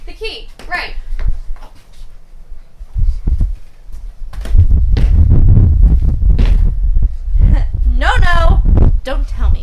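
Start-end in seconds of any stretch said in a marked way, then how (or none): 1.0-7.8 loud laugh. Acted. Long laugh at forgetting key.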